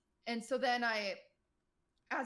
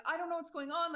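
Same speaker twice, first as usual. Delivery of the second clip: a raised, panicky voice; mock panic, not knowing what's happening; retold panic; 7 semitones higher, 4 dB louder than usual. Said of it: "like, panicked".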